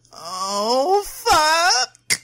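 rising scream. Acted. Woman shuddering at her girlfriend's touch, screaming. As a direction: cry climbing into a high, loud scream.

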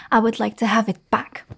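usual speaking voice

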